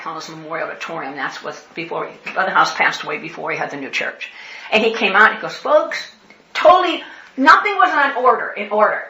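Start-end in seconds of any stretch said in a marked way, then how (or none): 5.6-9.0 loud, high excited announcement. Retold or imitated. Pastor bursting out to his congregation, excited and loud.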